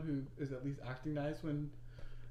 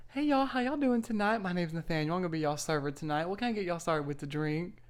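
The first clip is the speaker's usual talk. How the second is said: Southern accent; 4 dB louder than usual. Shown as a demonstration. Server greeting a table at the old job, in a Southern accent. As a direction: rattle off a Southern waiter's welcome. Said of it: "start with my Southern accent".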